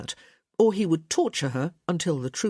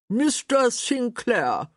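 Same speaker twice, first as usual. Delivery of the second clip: high, slow delighted welcome; delighted greeting; 7 semitones higher, 4 dB louder, slower than usual. Acted. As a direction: greet the guest warmly surprised. Mother greets surprise guest.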